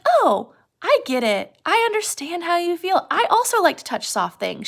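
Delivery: lightly acted eager sympathy, pitch lifting; mildly excited. Curly's wife bonding with Lenny, mildly excited. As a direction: gush a little, as if to a new friend. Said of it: "She's finally excited to have a friend".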